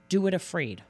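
ordinary delivery

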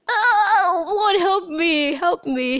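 high, slow, tearful plea breaking into a cry; real tears in a desperate prayer; crying out to God. Real, not acted. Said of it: "I need to just cry".

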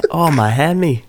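exaggerated Australian twang; Australian accent. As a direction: wail it in cartoon Australian. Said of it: "can't do an Australian accent".

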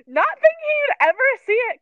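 high, loud, laughing through the words. Real, not acted. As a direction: giggle out the embarrassing part. Laughing at her own comment.

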